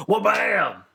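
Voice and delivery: mock impact shout. Sound effect in story.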